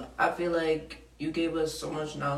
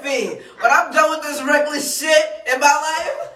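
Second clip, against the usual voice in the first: imagined future self; 5 semitones higher, 6 dB louder than usual. Retold or imitated. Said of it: "I'm gonna be like".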